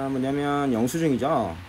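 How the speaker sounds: ordinary talk, nothing marked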